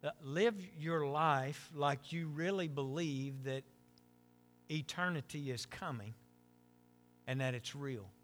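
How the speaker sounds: hushed heartfelt exhortation; quiet earnest preaching. Acted. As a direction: lay the key truth down gently and slowly. Soft slow core message.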